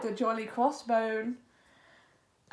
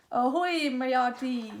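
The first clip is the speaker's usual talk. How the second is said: ordinary delivery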